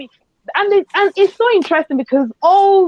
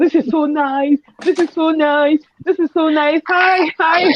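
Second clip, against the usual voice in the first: higher, gleeful repeats breaking into a long squeal; overjoyed; 4 semitones higher, slower than usual. Retold or imitated. Cook reliving joy at her moi moi, overjoyed. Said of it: "I was being so joyful".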